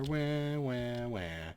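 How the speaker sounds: hammed-up fake sobbing, falling away; whiny fake wah wah; mock crying wahs. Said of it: "she immediately cried".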